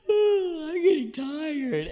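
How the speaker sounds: high, slow, strained little voice; straining body part. Acted. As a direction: squeeze it out as if giving way. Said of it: "my asshole's like".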